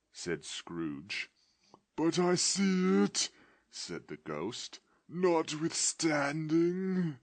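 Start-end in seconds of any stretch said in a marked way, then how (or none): 2.0-3.3 high, loud spectral moan; the dead partner answering from beyond; ghost's voice. Acted. Ghost answering Scrooge in a high wailing voice. 5.1-7.2 drawn-out high spectral moan; ghost's voice. Acted. Ghost insisting to Scrooge with a slow wail.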